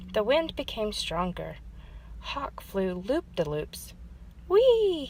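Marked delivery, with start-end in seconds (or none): 4.5-5.1 high, loud joyful whee; gleeful cry. A narrator's reading. Hawk flying loop-de-loops, gleeful high whee. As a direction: swoop up with delight. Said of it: "he cried".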